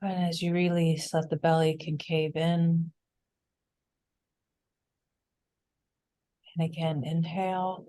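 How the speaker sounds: unhurried breath cues with long pauses; calm meditation leader, increasingly hushed; guided meditation. Real, not acted. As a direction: guide the group gently through each breath, softening as it settles.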